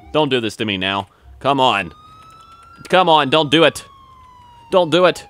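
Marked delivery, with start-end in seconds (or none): 1.4-5.2 higher, louder pleading to the game; real begging. Real, not acted. Gamer begging the game not to crash, loud pleading.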